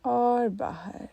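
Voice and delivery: very slow, stretched words; drawn-out exhale cue. Shown as a demonstration. Meditation teacher pacing listeners' exhale, slow and drawn out. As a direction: draw it out along the outgoing breath.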